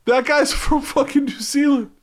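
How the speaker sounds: very high, loud yell from the back; squawking crowd heckler; heckler's shout. Acted. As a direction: shout out in high-pitched mock outrage. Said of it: "somebody stands up in the back".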